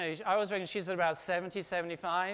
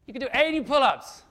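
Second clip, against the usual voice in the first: loud climax; 5 semitones higher, 10 dB louder than usual. Real, not acted. Climactic sermon point.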